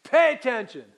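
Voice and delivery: a sudden loud, high "hey, pay attention"; mock call out. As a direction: snap the room to attention like calling to kids. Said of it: "in our vernacular, it'd be like".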